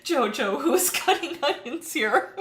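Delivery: high, loud joking complaint through emotion; mock accusation. Streamer teasing viewer about her tears, mock accusing. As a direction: playfully blame onions for the tears.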